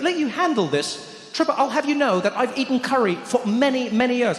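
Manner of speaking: high-pitched, huffy mock outrage; indignant friend. Friend defending his curry experience, high indignant voice. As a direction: pitch it up, affronted and self-important.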